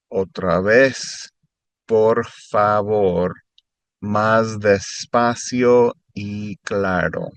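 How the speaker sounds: "Otra vez, por favor. Más despacio y claro" said slowly and clearly; slow and clear, for the learner; careful slow teacher repeat. Shown as a demonstration. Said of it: "slower and more clear".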